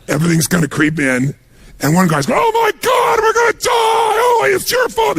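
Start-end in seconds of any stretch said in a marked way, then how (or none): 2.4-5.2 panicked scream. Retold or imitated. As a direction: scream it like you're about to die. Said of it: "He's screaming at the other guy".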